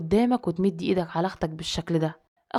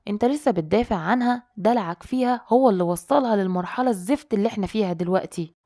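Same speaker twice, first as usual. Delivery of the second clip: ordinary delivery, nothing marked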